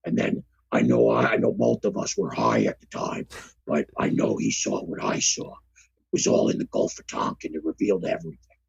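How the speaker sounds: very low, gravelly-free deep rumble; Jesse Ventura impression. Playing Jesse Ventura for cohost, very low voice. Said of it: "advertisement for the episode as Jesse the Body".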